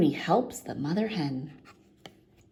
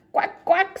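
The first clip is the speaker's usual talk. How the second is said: high, loud quacking; duck quack; 12 semitones higher, 7 dB louder than usual. A narrator's reading. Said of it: "says the duck".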